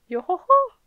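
high, loud yo-ho-ho; Brook's catchphrase laugh. Acted. Reader echoes Brook's catchphrase, high playful call.

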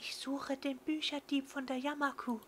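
high, hushed secret whisper; conspiratorial whisper. Acted. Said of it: "senkt ihre Stimme zu einem verschwörerischen Flüstern".

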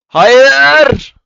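sudden high, loud cry of defeat; anguished no. Real, not acted. Gamer yelling as an opponent wins the round, anguished. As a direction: scream no as the round slips away. Loses the round to another player.

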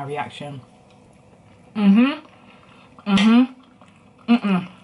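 talking while chewing food; muffled through a mouthful; mouth full, chewing. Real, not acted. Seen 2: big forkful at -4, lips closed and working through the words.

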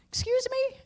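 high, laughing mock disbelief; incredulous. Preacher scoffing at people who say the old have lived, high incredulous laugh. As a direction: squeak it out like you can't believe it. Preacher mocks a dismissive claim.

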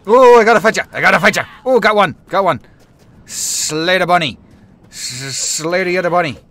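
loud high battle cries; excited yelling. Real, not acted. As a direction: yell hyped up while fighting. Fighting bunnies in the game.

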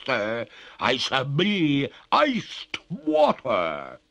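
high, loud, slow ghostly threat; threatening. A narrator's reading. Ghost warns Oglethorpe of her thaw, threatening. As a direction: draw out a wailing warning. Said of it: "cried the lady threateningly".

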